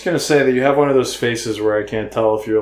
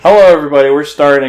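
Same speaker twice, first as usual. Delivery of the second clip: loud greeting; 4 semitones higher, 12 dB louder, faster than usual. Real, not acted. Restarting the video, loud greeting.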